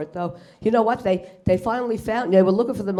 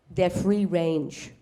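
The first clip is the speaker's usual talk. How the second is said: slow, low deadpan waitress voice; 3 semitones lower, 5 dB quieter, much slower than usual. Retold or imitated. Waitress answering the comedian, slow and low. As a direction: drawl it flat and slow like a bored waitress. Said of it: "She goes".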